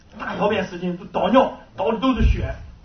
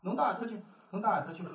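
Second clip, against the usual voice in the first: Shanghainese; 11 dB quieter, faster than usual. Retold or imitated. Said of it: "他就用一上海话".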